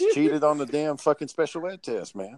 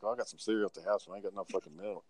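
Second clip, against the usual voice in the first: quiet inner thought; 6 semitones lower, 13 dB quieter than usual. Retold or imitated. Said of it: "I was like".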